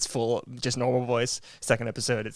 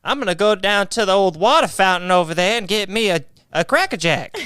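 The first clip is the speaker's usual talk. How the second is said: put-on higher, louder old-timey kid voice; old-timey boy; 6 semitones higher, 5 dB louder than usual. Old-timey kid asking for Cracker Jack, higher and louder. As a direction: play an eager kid from the 1900s.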